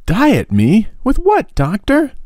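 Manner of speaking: put-on very high, dismayed boyish cry; boy aghast at the doctor's diet order, high and shocked. A narrator's reading. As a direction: squeal in disbelief at the word diet. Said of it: "Tom's face fell".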